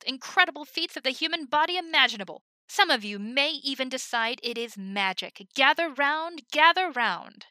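loud, high carnival barker calling a crowd; bright booming showman. Acted. Himmel announcing to the team as a showman, booming barker voice. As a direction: boom it out like a ringmaster. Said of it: "in a bright, booming voice".

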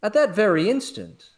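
usual speaking voice

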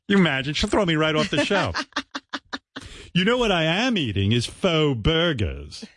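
usual speaking voice

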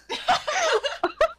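shrill burst of laughter; high squealing laugh. Real, not acted. Teasing Lynn about luck.

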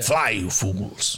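"Fly, you fools" low, slow and deep; deep slow Gandalf. Retold or imitated. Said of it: "I say it to people".

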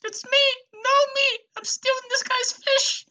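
tiny, extremely high squeaky gnome; very high-pitched little creature voice; gnome on a tape. Acted. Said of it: "here's what the V H S said".

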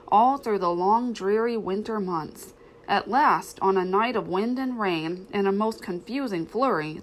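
usual speaking voice

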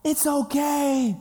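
high, slow sing-out as mom; mom calling. Retold or imitated. Mom answering her scared son, high reassuring call. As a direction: imitate a reassuring mother from afar. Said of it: "I hear my mom, and she's like".

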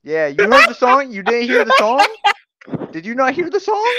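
high, loud laughing yell; laughing shout. Real, not acted. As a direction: shout it out while cracking up. Teasing shout over the song.

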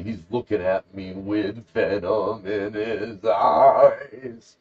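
slow, wavering, drawn-out melodrama; hammed-up quavering storyteller; mock-dramatic. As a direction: stretch each word with hammy menace. Hammed-up telling of monk's anger.

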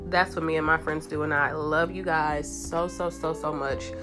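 ordinary talk, nothing marked

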